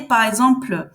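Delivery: usual speaking voice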